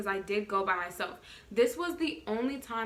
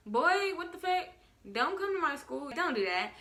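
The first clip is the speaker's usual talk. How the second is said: alarmed; 6 semitones higher than usual. Retold or imitated. Alarmed he may come.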